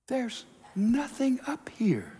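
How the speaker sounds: soft, slow, half-whispered amazement; hushed discovery. Acted. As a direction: let it out slowly in a near-whisper. Climber finds the top empty.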